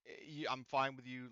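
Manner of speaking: usual speaking voice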